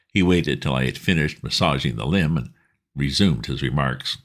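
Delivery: plain reading voice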